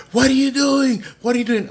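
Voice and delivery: very high, urgent mock scolding; high-pitched, panicky repeated demand; alarmed mother. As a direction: imitate a mom catching her kid. Mom catches boy with dolls.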